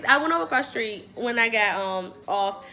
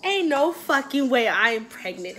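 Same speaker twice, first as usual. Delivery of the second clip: normal talking voice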